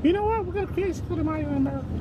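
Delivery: tiny high falsetto office gossip; soft, high-pitched mock chatter; Jackie. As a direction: squeak it softly, very high. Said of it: "She's always talking about Cinco de Mayo".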